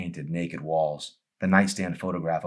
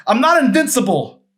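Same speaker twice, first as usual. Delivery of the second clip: very high and loud, fast, breaking apart; husband begs to fix it; anguished plea; 11 dB louder than usual. Acted. Joel final plea.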